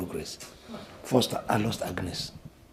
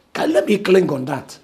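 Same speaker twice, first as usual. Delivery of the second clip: loud pleading; 6 semitones higher, 8 dB louder than usual. Acted. Pleading for hope.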